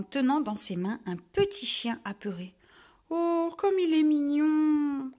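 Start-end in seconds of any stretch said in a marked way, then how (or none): 3.1-5.1 high, loud, slow gushing over a puppy; crying out. A narrator's reading. Barbie seeing the lost puppy, crying out fondly, louder and slow. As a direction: melt over the tiny dog. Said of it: "s'écrie Barbie".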